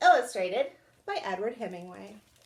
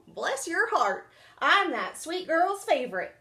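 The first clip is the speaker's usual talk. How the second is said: squawked; 6 semitones higher than usual. A narrator's reading. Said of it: "squawked Feathers".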